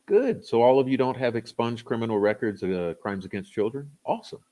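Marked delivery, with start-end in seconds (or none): 0.1-0.5 high mock-cheerful sarcastic good. Sarcastic reply to a chat comment. 4.1-4.4 high mock-cheerful sarcastic awesome. Sarcastic reply to a chat comment.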